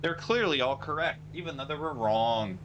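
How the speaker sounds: high-pitched exasperated sarcasm; sarcastic. Real, not acted. Sarcastic rant about being ignored.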